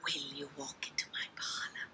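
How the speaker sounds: low whispered character voice; whispering spider. Shown as a demonstration. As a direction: whisper the invitation softly, very low. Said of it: "let's try some different voices".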